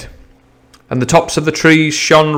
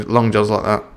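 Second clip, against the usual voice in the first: ordinary delivery, nothing marked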